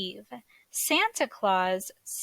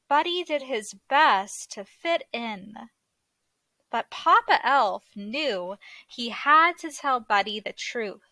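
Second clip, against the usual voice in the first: plain reading voice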